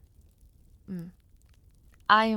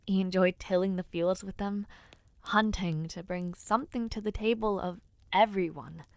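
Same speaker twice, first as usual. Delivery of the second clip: normal talking voice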